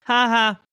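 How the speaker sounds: high sarcastic "ha ha"; fake laugh. Mock fake laugh at a joke.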